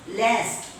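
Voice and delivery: LAST with front "a" as in cat; American last. Shown as a demonstration. Teacher repeating American word, LAST with cat vowel. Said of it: "In American English".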